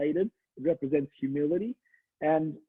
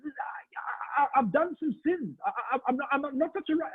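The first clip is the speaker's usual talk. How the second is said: frightened stammer; 10 semitones higher than usual. Acted. Terrified preacher confessing to the rabbi, higher frightened stammer. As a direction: stumble over the words, scared. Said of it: "This guy starts to freak out".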